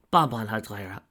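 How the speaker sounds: plain reading voice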